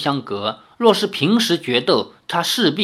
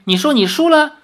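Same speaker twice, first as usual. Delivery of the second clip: much higher, louder, beaming; delighted question; 12 semitones higher, 5 dB louder than usual. Acted. Lin Yueru delighted that her father admits defeat, much higher and beaming. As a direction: bubble over with glee. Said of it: "居然说得眉开眼笑".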